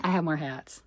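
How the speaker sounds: ordinary talk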